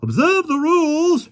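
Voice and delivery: high, slow, loud shriek. A narrator's reading. Park keeper shrieks at the lion, very high shriek. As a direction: shriek the rules in panic. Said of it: "shrieked the park keeper".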